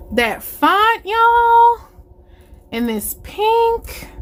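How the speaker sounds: high, gushing and thrilled; excited voice. Real, not acted. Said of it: "with my exciting voice".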